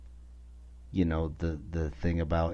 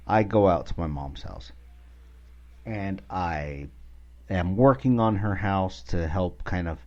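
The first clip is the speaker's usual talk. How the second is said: ordinary delivery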